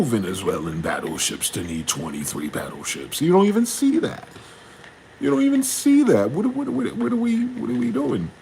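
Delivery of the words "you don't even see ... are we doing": loud, high-pitched incredulous outburst; exasperated disbelief. Real, not acted. Ranting about warships nobody needs, exasperated.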